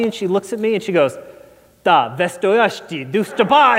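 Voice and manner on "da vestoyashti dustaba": imitating the grandma's Russian, slow; rattling off fake Russian words; grandma answering in Russian gibberish; mock Russian. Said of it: "and just Russian".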